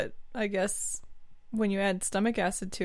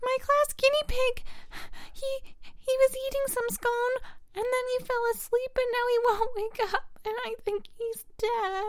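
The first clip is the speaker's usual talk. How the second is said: put-on tearful child. Child telling Dad the class guinea pig died, sobbing. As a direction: squeak it out through tears. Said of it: "tears aren't gonna make a difference".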